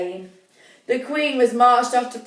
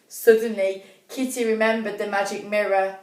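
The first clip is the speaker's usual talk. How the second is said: ordinary delivery, nothing marked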